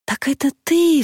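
high-pitched cry of recognition; startled heroine, voice shooting up; astonished exclamation. A narrator's reading. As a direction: exclaim in amazement, pitch leaping. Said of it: "вскричала Анюта с удивлением".

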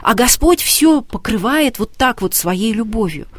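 ordinary talk

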